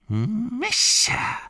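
Gollum's final guess. Acted. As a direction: squeal the guess eagerly. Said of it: "sagte er schließlich". (hohe, gierige Gollum-Stimme)

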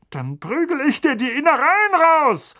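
loud, high furious threat; blustering rage. A narrator's reading. Uncle threatening Harry, raging. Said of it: "polterte Onkel Vernon".

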